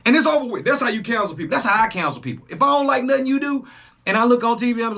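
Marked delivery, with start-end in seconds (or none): none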